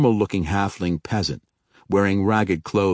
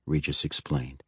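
usual speaking voice

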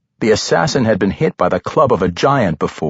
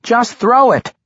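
high, louder halfling cry; urgent cry; 13 semitones higher, 4 dB louder than usual. A narrator's reading. Halfling urging barbarian to throw at the goblins, crying out. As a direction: cry out a desperate order. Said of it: "the halfling cried".

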